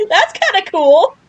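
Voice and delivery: laughing through the words, slow; giggle, then marvel while still laughing; real delighted laugh. Real, not acted. To viewers after the creature came close, real laughing delight.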